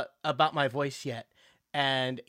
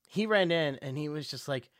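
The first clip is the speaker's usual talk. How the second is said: usual speaking voice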